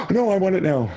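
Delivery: high-pitched gasp and playful protest; high, hammed-up panic; mock alarmed protest. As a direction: act out a reader clutching at the book. Acts reader losing an unread book.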